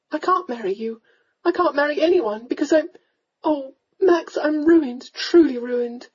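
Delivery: high, distressed confession; desperate. A narrator's reading. Young lady confesses ruin to the lord, desperately. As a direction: plunge on desperately, voice pitched high. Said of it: "desperate to put this agonizing scene".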